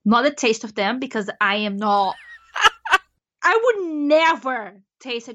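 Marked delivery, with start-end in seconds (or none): 2.2-3.0 short high laugh; quick high chuckle. Real, not acted. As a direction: break into high-pitched laughter. Nervous laugh about grasshoppers.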